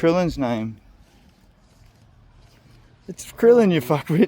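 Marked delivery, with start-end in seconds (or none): 3.1-4.3 loud, high teasing yell; high shouted insult, half laughing; mock exasperated shout. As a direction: blurt it out at your mate. Mock outburst at friend.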